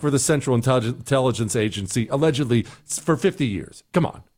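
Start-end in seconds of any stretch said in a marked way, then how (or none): none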